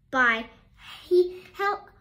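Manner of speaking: plain reading voice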